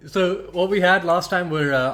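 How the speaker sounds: ordinary talk, nothing marked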